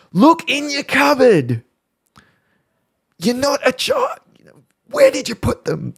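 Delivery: put-on shrieking at a kid who can't find his socks; imitated yell at son. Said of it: "I yell something like".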